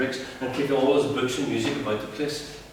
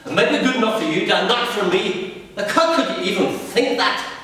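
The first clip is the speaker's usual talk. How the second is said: higher, fast then slowing, indignant; heated refusal; 7 semitones higher than usual. Acted. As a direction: son throwing the offer back at dad, indignant. Son rejecting father angrily.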